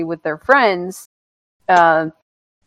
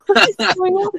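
baffled viewer; 11 semitones higher than usual. Retold or imitated. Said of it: "and you're like".

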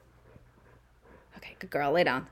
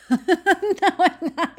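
higher and louder, cheeky take-back; loud laughing retort; 11 semitones higher, 9 dB louder than usual. Real, not acted. Taking back the apology to her sister, loud and gleeful. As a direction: blurt it out gleefully.

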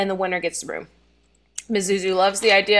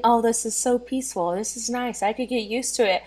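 a soft, quieter, lightly voiced girl at peace; dreamy contentment; 6 dB quieter than usual. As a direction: murmur it like a lazy happy afternoon. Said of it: "she's, like, saying".

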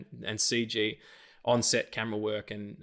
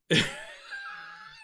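sudden squeal of a laugh; high burst of laughter. Real, not acted. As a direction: crack up high and breathless. Laughing at his favorite line.

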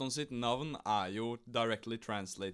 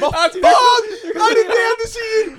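very high, loud laughing protest; laughing outraged shout; 16 dB louder, slower than usual. Real, not acted. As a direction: shriek it in laughing disbelief, over and over. Outraged at being told wrong.

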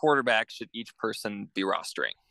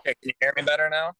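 nasal phone audio; 4 semitones higher, 4 dB louder, faster than usual. Real, not acted. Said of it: "You just sound nasally".

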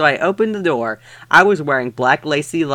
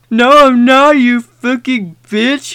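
higher, louder angry shout; 8 semitones higher, 4 dB louder than usual. Acted. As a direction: snap it out like a furious goth boy. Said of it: "he shouted angrily".

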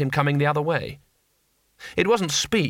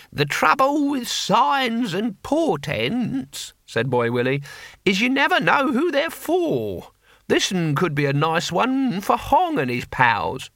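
put-on much higher, slow, cracked old-man voice; old barbarian's voice; 7 semitones higher, slower than usual. A narrator's reading. Boy Willie muses on signs to Cohen, high slow old voice. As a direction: croak it out like a wizened old fighter.

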